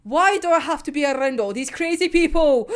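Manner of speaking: loud laughing exasperation; hammed-up exasperated yell; exasperated shout. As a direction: throw the complaint out loud. Sigma exasperated outburst.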